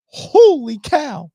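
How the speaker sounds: slow, loud, drawn-out holy cow; real disbelief at a groundhog recipe; stunned. Real, not acted. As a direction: let the shock stretch the words out.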